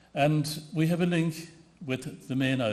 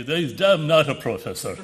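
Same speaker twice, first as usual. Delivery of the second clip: laughing retort; 2 semitones higher, 7 dB louder than usual. Retold or imitated. Retold pub banter, laughing.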